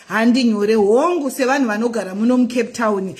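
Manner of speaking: ordinary delivery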